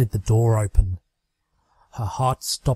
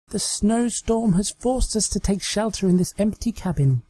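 higher, hammed-up melodrama distress; overly dramatic; 9 semitones higher than usual. Trixie acting out a cabin scene with her doll, higher and hammed-up. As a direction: ham up the snowstorm peril. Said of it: "in an overly dramatic voice".

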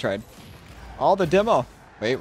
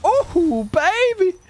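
much higher, thrilled; high excited cheer; 12 semitones higher, much slower than usual. Real, not acted. Gamer celebrating a great shot, high cheer. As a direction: squeal it up high.

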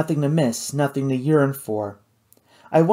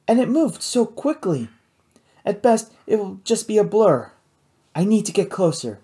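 lightly voiced young woman, higher; higher, earnest girl's voice; 7 semitones higher than usual. A narrator's reading. Kate voiced in story.

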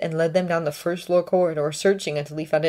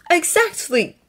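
higher, relieved agreement; Draco agreeing; 12 semitones higher, 3 dB louder, slower than usual. A narrator's reading. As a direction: back her up with relief.

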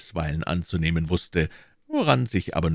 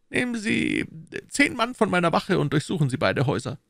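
higher, pressing questions as the Cardinal; Cardinal questioning; 13 semitones higher than usual. Acted. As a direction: interrogate his agent coolly. (der Kardinal, verhörend)

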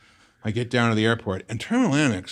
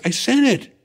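put-on insistent girlfriend; 7 dB louder than usual. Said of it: "She goes".

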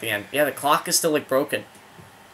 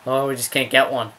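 usual speaking voice

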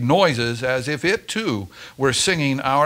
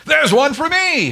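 loud, high eager holler; 14 semitones higher, 5 dB louder than usual. A narrator's reading. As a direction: shout it out, grabbing for the package. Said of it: "hollered Kelly".

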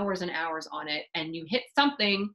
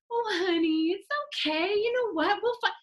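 chirpy parent; 11 semitones higher than usual. Acted. Parent answers upset child cheerfully.

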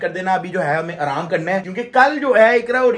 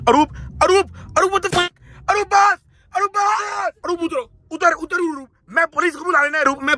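mock panic yelling; 10 semitones higher than usual. Passenger yells at the driver to stop and get out. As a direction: scream at her to stop the car.

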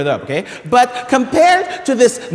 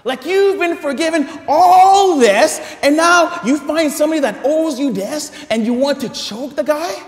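outraged exclaim; 7 semitones higher, 5 dB louder than usual. Real, not acted.

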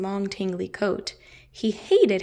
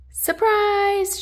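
high, loud cheer; shouted surprise; 10 semitones higher, 5 dB louder than usual. Acted. Friends springing the surprise on Hamish, loud cheer. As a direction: burst out with it. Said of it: "shouted his friends".

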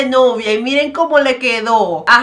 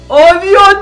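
louder, higher Oh, Dios with stress on Dios; alarmed oh God; 4 semitones higher, 7 dB louder than usual. Real, not acted. Vlogger as stage collapses, alarmed outburst. Same words said twice.

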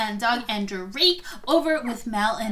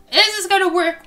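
higher, louder urgent question; excited; 5 semitones higher, 6 dB louder than usual. Real, not acted. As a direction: ask it eagerly, pushing. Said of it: "We need to know".

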